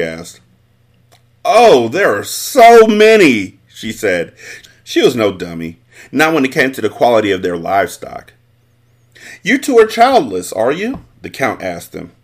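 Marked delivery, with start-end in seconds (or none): 1.4-3.6 high, slow, gushing wife; a proud farm wife fluttering over praise; the mother drawn out high and slow; voiced mother. Mother answers the Count. 9.4-11.0 higher, pointed nobleman's question; a lordly prying query; a hint of the haughty Count; lightly voiced Count.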